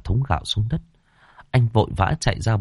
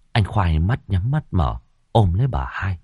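usual speaking voice

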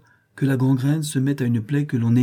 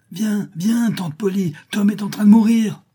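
high alarmed shout, louder at the end; frightened cry; 11 semitones higher, 5 dB louder than usual. A narrator's reading. Frightened brother calls his aunt upstairs, alarmed cry. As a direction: call out in panic for help. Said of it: "effrayé ... et s'écria".

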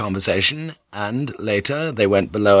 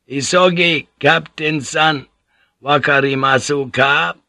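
high, loud call across the deck; shouted; 5 semitones higher, 3 dB louder than usual. Acted. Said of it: "shouted at the captain".

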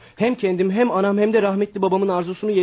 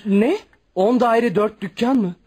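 astonished; 5 semitones higher, 4 dB louder, slower than usual. Acted. Son hears of the fortune.

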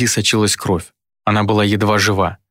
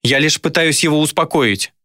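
higher-pitched woman's protest; pleading self-justification; defensive protest; 6 semitones higher than usual. A narrator's reading. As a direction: defend yourself in a raised voice. Dayna defends herself to Viktor.

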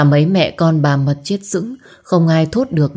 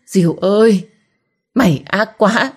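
pained cry; 4 semitones higher than usual. A narrator's reading. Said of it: "hét lên đau đớn".

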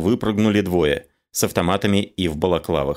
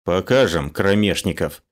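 ordinary delivery, nothing marked

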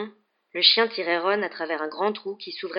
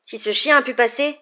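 out of breath; 4 semitones higher, 9 dB louder than usual. A narrator's reading. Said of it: "dit Harry le souffle court".

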